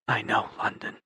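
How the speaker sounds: tender whisper. Acted. Dawson whispers back to London, tender. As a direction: whisper close to her face. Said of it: "he whispered near her face".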